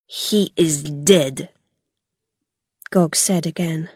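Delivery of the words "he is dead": slow, low, cold confirmation; cold insistence. A narrator's reading. Gog to shocked Rilla, cold and final. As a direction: state it slowly and heavily, pitched down.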